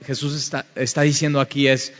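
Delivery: normal talking voice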